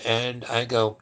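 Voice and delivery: ordinary delivery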